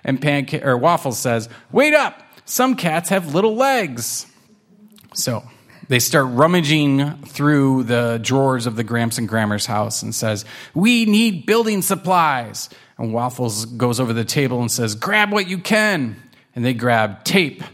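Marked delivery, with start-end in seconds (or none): 1.7-4.3 high-pitched complaining kitten; high, mildly put-out kitten voice; calling after. Acted. Kitten calls after the other. 10.7-12.7 high, eager kitten voice; high-pitched keen declaration; purposeful kitten. Acted. Kitten gives orders in story. 14.9-16.2 high, eager kitten voice; high-pitched keen call; urging kitten. Acted. Kitten gives orders in story.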